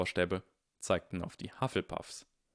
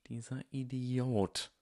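slow, higher-pitched hiss of disgust; 5 semitones higher, slower than usual. A narrator's reading. Draco curses Goyle flying off on the broom, hissing. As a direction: hiss it slowly. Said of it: "zischte Draco".

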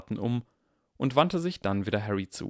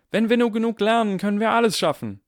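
much higher and louder, proud chant; rallying cry; 12 semitones higher, 8 dB louder than usual. Acted. General Granger leading her soldiers' reply, rallying shout. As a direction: shout the moral to the troops.

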